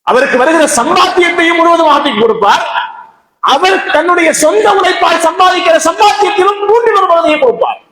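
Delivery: high-pitched, ringing sermon delivery; impassioned preaching. A narrator's reading. Preacher telling listeners of the governor's charity, impassioned preaching. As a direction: preach it high and sustained, driving each phrase home.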